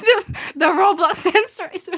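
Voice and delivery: giggling through the words; can't stop laughing at it. Real, not acted. Laughing to viewers about the game's censor.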